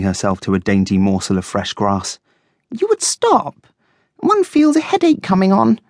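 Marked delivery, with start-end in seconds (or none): 2.7-5.8 very high-pitched, put-upon guinea pig; piercingly high complaint; complaining. A narrator's reading. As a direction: grumble in a high, prim character voice. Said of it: "she complained".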